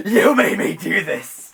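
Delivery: slow, high, full-voiced scream; unhinged, high-pitched shriek; hysterical screaming. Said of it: "[name], hysterical, screaming".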